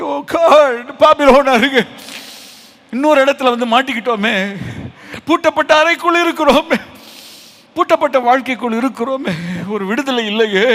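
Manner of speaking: high-pitched, pleading lament; hammed-up anguished plea, easing at the end; acted tearful prayer. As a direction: cry out to God as a captive shut away. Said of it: "கண்ணீர் விட்டு ஜெபித்தானே".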